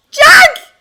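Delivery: put-on a little girl's sudden cry. A narrator's reading. Little sister crying out as the ice cracks, high and loud.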